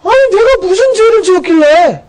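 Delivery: high, indignant voice of Job asking why; Job's protest. Retold or imitated. Job protesting to God about his suffering, indignant high voice. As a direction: cry out as the wronged Job. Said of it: "이런 얘기죠".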